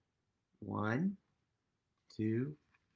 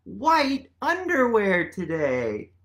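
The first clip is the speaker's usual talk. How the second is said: polar bear; 5 semitones higher, 5 dB louder than usual. A narrator's reading. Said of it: "says polar bear".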